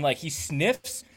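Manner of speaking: usual speaking voice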